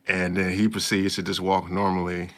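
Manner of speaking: ordinary talk, nothing marked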